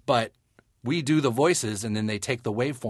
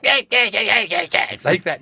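big chesty growl, chest pushed out; puffed-chest Cartman voice; 10 semitones higher, 10 dB louder than usual. Shown as a demonstration. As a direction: puff up and push it from the chest. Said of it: "puffing my chest out and really going".

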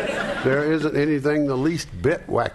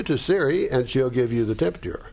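normal talking voice

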